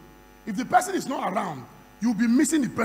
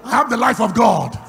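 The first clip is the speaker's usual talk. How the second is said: full-force preaching; 3 semitones higher, 11 dB louder than usual. Real, not acted. Preacher asking who lives inside them, booming call-and-response. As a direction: proclaim each phrase loudly to rouse the congregation.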